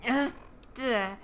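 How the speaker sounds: usual speaking voice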